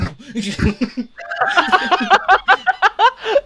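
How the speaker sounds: loud, high burst of laughter; cracking up, loud and high. Real, not acted. Laughing at own story.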